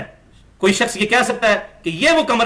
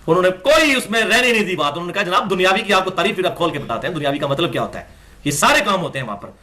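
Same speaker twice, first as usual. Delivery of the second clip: ordinary talk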